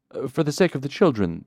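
pleading. Acted. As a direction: beg for the children. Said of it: "the bald man pleaded".

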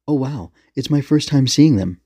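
put-on thrilled young fan; excited. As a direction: gush at a first sighting. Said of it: "Eiji was immediately excited".

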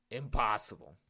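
loud, stunned exclamation; loud disbelief. Acted. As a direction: blurt it out in disbelief. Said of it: "Chris said".

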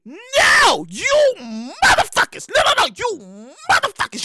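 slow, swooping scolding; comic outrage. Real, not acted. Host telling three siblings off for rescheduling a holiday, outraged. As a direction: stretch out the curse at them with indignation. Cursing out siblings, kinda mad.

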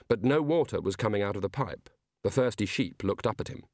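plain reading voice

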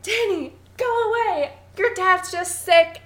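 high-pitched urgent mother imitation; Wendy. Podcaster acts out mother sending her son away, high pleading call. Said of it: "And Wendy's like".

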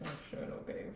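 meek whisper. Retold or imitated. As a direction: whisper it, caught out. Meekly obeying boyfriend.

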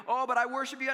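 mock pious whine. Mocking hollow worship.

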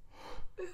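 sharp high breathless gasp; soft shocked gasp; startled gasp. Retold or imitated. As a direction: gasp as the knock comes. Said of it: "I was like".

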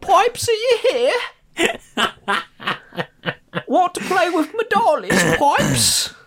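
put-on very high, creepy doll-like child voice; a grown woman playing a haunted little girl. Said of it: "she's like".

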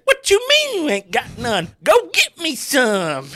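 high, loud, slow hillbilly demand; hammed-up high West Virginia outrage; angry Jimbo. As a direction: whine it out like an angry coach. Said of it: "He said".

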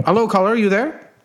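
normal talking voice

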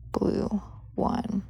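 usual speaking voice

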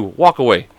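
ordinary talk

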